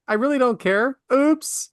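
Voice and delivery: high, careless mock oops; snooty. Host imitating a texter who doesn't care, snooty. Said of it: "It's very snooty".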